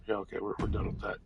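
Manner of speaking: fast unvoiced mutter under breath; muttered aside. Real, not acted. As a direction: mutter it quickly to yourself. Muttered aside after dismissal.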